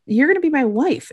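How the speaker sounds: high, insistent imitated plea; acted protest. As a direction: protest it with pitch jumping up. Acts Hot's protest.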